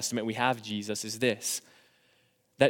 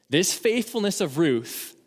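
ordinary talk, nothing marked

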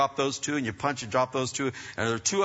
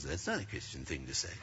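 very low, hushed mimicry of a scold; mimicked reproach; 12 semitones lower, 13 dB quieter than usual. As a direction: parrot her reproach under your breath. Coworker's reproach, mimicked under breath.

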